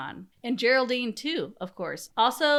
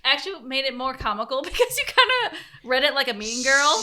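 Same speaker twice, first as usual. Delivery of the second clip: laughing; 4 semitones higher, 5 dB louder than usual. Real, not acted.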